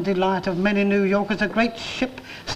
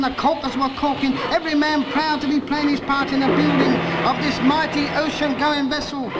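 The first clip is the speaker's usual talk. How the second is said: ordinary talk, nothing marked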